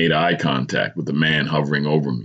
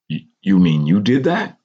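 stammered, higher incredulous question; disbelief; 4 semitones higher, 3 dB louder than usual. A narrator's reading. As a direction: ask as if you can't believe it. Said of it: "the paramedic asked in disbelief".

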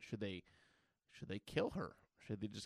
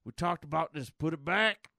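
higher, louder stern professor impression; Patrick Stewart as Professor X; 4 semitones higher, 5 dB louder than usual. Professor telling Wanda to put it back, impersonated elder statesman. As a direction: scold her in a put-on voice, building on the last words. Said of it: "It sounds just like Patrick Stewart".

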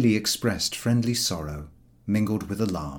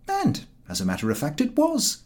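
usual speaking voice

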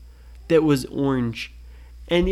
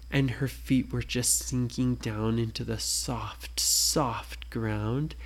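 unvoiced whisper, sinking into softness; 4 semitones lower, 7 dB quieter than usual. A narrator's reading. Parent telling a bedtime story, whispering. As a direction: whisper it like feet in velvet. Feet sinking into soft ground.

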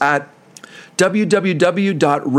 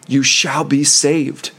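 ordinary delivery

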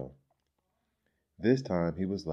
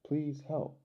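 high, pleading voice; pitched-up storybook begging; 10 semitones higher than usual. A narrator's reading. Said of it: "Nico begged the villagers".

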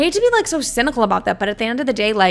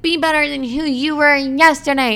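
higher put-on voice mocking the slogan; 6 semitones higher than usual. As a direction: parody the girl boss accounts, pitched up. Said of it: "they're just like".

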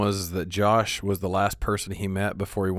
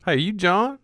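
much higher questioning voice; mock mishearing; 11 semitones higher, slower than usual. Imitating coworkers asking if his name is John. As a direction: mimic someone squinting at the name.

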